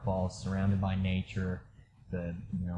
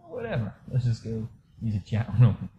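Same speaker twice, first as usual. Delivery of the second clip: mock careless shrug; 3 semitones higher, 4 dB louder than usual. Shrugging off help, in character.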